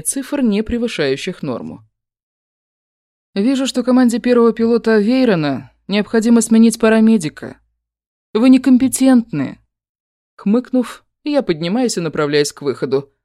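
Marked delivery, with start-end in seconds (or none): 3.3-9.6 cold put-down. Acted. Cool put-down of the paramedic.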